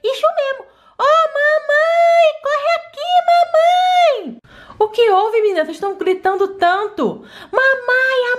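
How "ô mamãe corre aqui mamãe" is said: high, drawn-out call for mom; long high call to mother. Acted. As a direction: call mom from across the house. Kids calling mother urgently.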